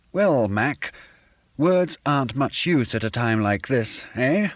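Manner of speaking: hearty fake-posh chum; Bob's fake genteel voice. A narrator's reading. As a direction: play the smug, put-on gentleman. Bob's voice in dialogue.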